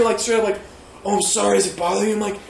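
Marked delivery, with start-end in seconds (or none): 1.0-2.1 lightly voiced earnest Batman apology; sincere Batman-like voice. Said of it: "he was totally sincere".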